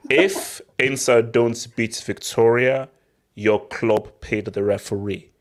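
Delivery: slow, deliberate explaining; mock patience. Shown as a demonstration. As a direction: spell it out slowly like to a child. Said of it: "I'll go slower".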